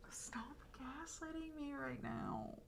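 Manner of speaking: slow hissed whisper, mock fury; mock raging whisper. Streamer to chat, mock furious slow whisper. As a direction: whisper it like a furious joke accusation. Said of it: "She's utterly raging".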